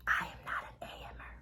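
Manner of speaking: hushed confession, no voice; whispering. Real, not acted. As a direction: breathe it out as a secret. Confessing she hates early trips.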